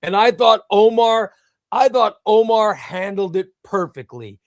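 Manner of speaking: ordinary talk, nothing marked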